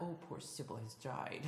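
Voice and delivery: hushed put-on voice, half laughing; character impression. Fan doing a show character for a laughing co-host, soft put-on lament. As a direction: play it soft and mock-mournful. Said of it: "The only impressions I can do".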